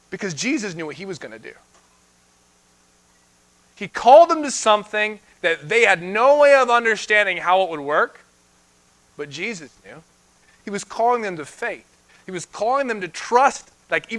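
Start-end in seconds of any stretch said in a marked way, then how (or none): none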